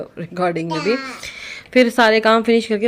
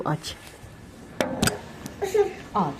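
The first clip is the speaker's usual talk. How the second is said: normal talking voice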